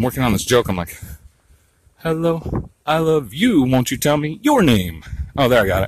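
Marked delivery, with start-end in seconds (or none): 2.0-5.0 half-sung, "your" lifted high then falling on "name"; sing-song stress on YOUR. Shown as a demonstration. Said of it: "I finally emphasized it right".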